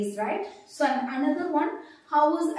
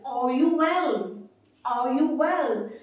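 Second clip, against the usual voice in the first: "Are you well" drawn out slowly, falling on "well"; slow teacher's demo of "Are you well" with a falling tune; question intonation demo; 4 dB louder, slower than usual. Shown as a demonstration. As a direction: model "Are you well" slowly, leaning on "you", dropping at the end. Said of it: "intonation is very important".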